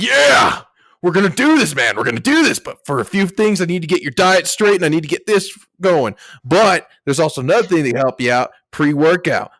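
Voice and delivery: hammed-up fitness coach hyping a student; pumped-up coach. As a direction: pump him up like a bro trainer. Pumped coach voiced in story.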